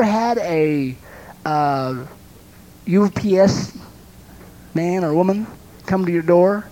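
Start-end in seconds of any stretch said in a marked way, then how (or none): none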